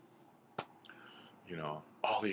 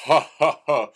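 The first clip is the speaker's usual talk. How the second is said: very loud, high "ha, ha, ha"; big mocking belly laugh, acted; separate, forceful bursts of laughter; giant's laugh; 8 semitones higher, 15 dB louder than usual. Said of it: "Big Elk just laughs".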